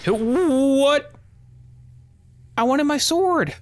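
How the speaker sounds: very high, loud, whiny outburst; shocked high yelp. Real, not acted. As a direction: shriek in disbelief then whine. Killed suddenly in game.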